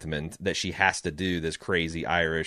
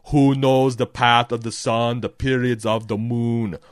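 loud, grandly declaimed action-hero chant; Arnold Schwarzenegger; 2 semitones higher, 6 dB louder than usual. Acted. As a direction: commit fully to the booming incantation. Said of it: "sounds like I'm doing an Arnold Schwarzenegger".

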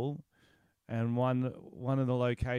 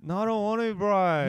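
possessive whining; 7 semitones higher, 5 dB louder than usual. Real, not acted. Refusing to take his turn.

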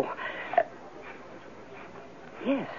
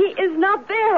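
frantic; 10 semitones higher than usual. Acted. Frantic mother.

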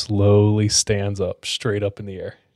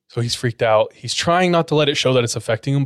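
normal talking voice

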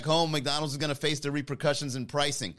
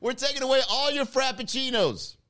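taunting Russia; 11 semitones higher, 4 dB louder than usual. Pretend.

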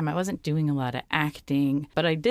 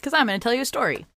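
gather the listeners round; urban legend teller; 4 semitones higher than usual. Acted. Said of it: "let's go live with Story Corner".

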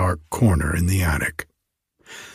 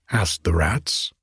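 ordinary delivery

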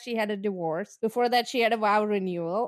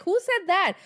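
mock puzzled; 8 semitones higher than usual. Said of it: "I'm like".

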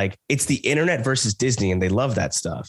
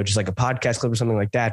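ordinary talk

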